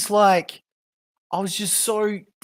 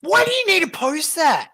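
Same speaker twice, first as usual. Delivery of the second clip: exasperated; 10 semitones higher, 6 dB louder than usual. Real, not acted. Exasperated at the mother's post.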